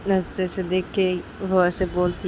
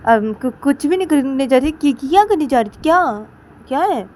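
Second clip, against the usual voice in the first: flustered loud denial; 7 semitones higher, 9 dB louder than usual. Acted. Sisters bickering, caught out.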